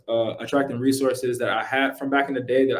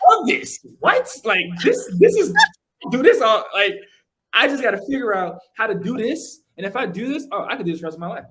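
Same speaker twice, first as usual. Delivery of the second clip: pitched way up, bursting with thrilled amazement; excited; 7 semitones higher, 3 dB louder than usual. Retold or imitated. Watching the meditation group blow up, high thrilled exclamations. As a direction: squeal it like you just found your calling.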